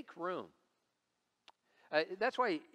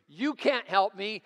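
loud, high, slow mock protest; upset daughter; 7 semitones higher, 10 dB louder, slower than usual. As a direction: imitate a child loudly rejecting dad's help. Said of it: "she's really getting upset".

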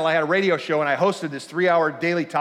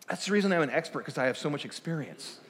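softer, lower defensive reply; 4 semitones lower, 9 dB quieter than usual. Retold or imitated. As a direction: mutter the excuse down. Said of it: "And she said".

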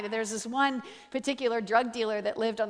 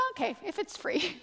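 reluctant agreement; 6 semitones higher, 3 dB quieter than usual. Retold or imitated. Wary dealer accepting free word.

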